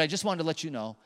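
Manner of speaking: soft, low, gentle encouragement; tender reassurance. Retold or imitated. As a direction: hush it down, kind and sincere. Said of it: "He said".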